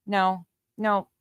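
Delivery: ordinary talk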